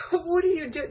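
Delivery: high, loud startled cry; a driver yelping at a screaming passenger; startled outcry. Retold or imitated. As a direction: jump out of your skin and shout it. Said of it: "I was like".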